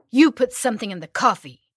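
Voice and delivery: loud angry accusation; shouting. Acted. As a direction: shout the realisation.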